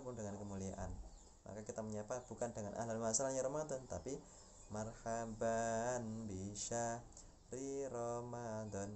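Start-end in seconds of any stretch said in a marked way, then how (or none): 4.7-8.9 slow greeting. Shown as a demonstration. Teacher models greeting phrase.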